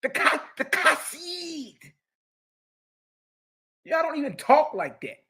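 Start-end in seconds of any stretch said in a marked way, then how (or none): 0.0-1.8 "Kasid" high with a choked throaty K; exaggerated guttural "Kasid"; mock Hebrew. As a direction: choke on the K for a laugh. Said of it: "they choke on the K's".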